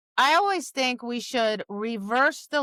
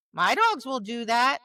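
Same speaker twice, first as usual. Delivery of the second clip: usual speaking voice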